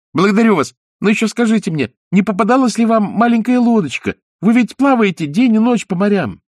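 put-on high, eager puppet boy; wooden boy voice. A narrator's reading. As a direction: pipe it like a small boy.

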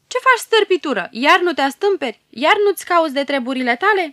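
the hero scolding the bearded ruler angrily; angry scolding. Acted. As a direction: tear into the little troublemaker. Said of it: "se răstește la el mânios".

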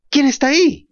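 high, loud scared cry; frightened shout. Acted. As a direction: call out into the dark, afraid. (grito asustado y agudo)

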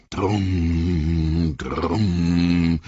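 drums rolling up from the dark; booming war drums. A narrator's reading. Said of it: "dröhnten die Trommeln".

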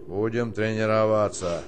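ordinary talk, nothing marked

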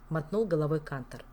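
ordinary delivery, nothing marked

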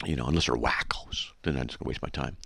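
soft, unvoiced whisper; whispered aside. Real, not acted. A private aside to the viewer, whispered. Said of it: "whispered aside".

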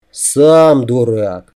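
slow, drawn-out grumble; sulky retort. A narrator's reading. He answers her teasing compliment, slow and sulky. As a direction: drag it out in offended protest.

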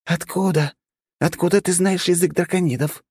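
put-on high, startled girl's outcry; startled cry. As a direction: blurt it out in shock. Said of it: "выкрикнула она". (высокий изумлённый выкрик)

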